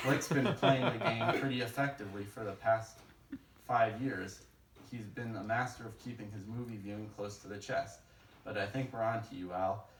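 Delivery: ordinary talk, nothing marked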